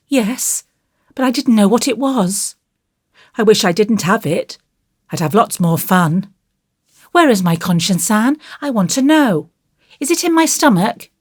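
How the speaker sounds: put-on high, earnest child's voice; curious little boy. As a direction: puzzle it out like a small boy. Voices curious young Davy.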